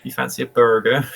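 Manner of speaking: high, hammed-up West Country burr; put-on Bristol accent. Shown as a demonstration. Offering a burger to "my love", exaggerated Bristol accent. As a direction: play a broad Bristol local offering food. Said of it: "Trying to figure out how to say it".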